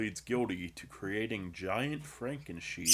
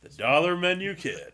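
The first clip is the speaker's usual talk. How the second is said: ring announcer; 9 semitones higher, 7 dB louder than usual. Acted.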